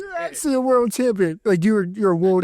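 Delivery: can't believe it, high; surprised. Real, not acted. Stunned at the news.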